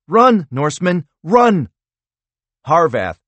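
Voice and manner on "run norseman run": high, loud urgent cry; urgent yell. A narrator's reading. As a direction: yell at him to run.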